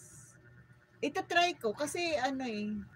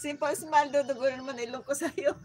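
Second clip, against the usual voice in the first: laughing; 2 semitones higher, 6 dB louder than usual. Real, not acted. Laughing shout out.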